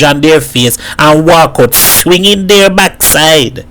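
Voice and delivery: indignant neighbour woman in Trinidad dialect; scornful Missus Baku. Acted. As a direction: run down the modern girls with relish. Missus Baku passes scornful judgment.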